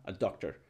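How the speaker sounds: "a" said very short, low and soft before "doctor"; "a doctor" with a tiny quick schwa, dropping to a low murmur; weak a. Shown as a demonstration. Teacher demonstrating the reduced article for the class. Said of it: "Se escucha la A, casi no".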